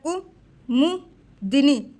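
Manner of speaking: कुमुदिनी split as ku, mu, dinee; spaced-out, dictated कुमुदिनी; syllable by syllable. Shown as a demonstration. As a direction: slowly chop कुमुदिनी into syllables. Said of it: "इस तरीके से लिखा जाता है".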